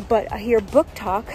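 ordinary talk

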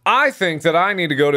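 excited exclamation. Real, not acted. Gamer exclaiming before going out.

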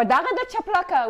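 mother threatening her kid with a sandal, a mock scolding; scolding mom. Mother to child about the sandal, mock threat. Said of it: "our moms would say".

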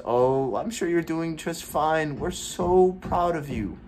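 high, slow, syrupy mock praise; mocking sweet voice. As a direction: parody the relatives' fake comfort. Mocks family's empty encouragement.

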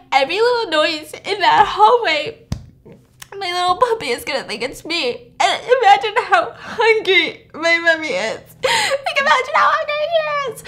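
high-pitched, hammed-up wailing; mock crying. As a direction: blubber comically, half laughing. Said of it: "she starts crying and she's like".

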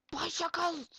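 high, loud delighted cry; excited shout. Real, not acted. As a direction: squeal at the discovery. Spots bicycles.

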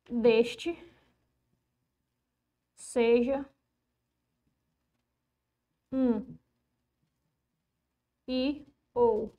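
very slow, long gaps after words; halting board dictation, word after word. Real, not acted. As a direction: dictate slowly while writing on. Dictates while writing the rule.

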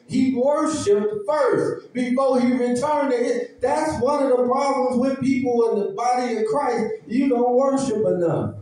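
high, deliberate sermon delivery; emphatic preaching. Acted. Preacher urging the church to worship first, emphatic preaching.

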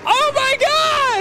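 high, stretched-out cry; outraged shock. Real, not acted. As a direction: wail it in disbelief. Wheel spin goes badly.

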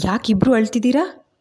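puzzled, asking why both cry; surprised son. A narrator's reading. Karna finds mother and stranger weeping, surprised. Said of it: "ಕರ್ಣನಿಗೆ ಆಶ್ಚರ್ಯ".

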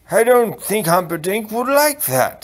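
high, loud character voice; doddering king. Acted. King offering his opinion to the others, high doddery voice. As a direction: pitch it up as a muddled old king.

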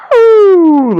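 strained high throat clear; cracking throat clear. Real, not acted. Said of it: "Lan sesim gitmiş".

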